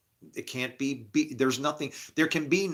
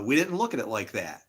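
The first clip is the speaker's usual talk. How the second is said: ordinary delivery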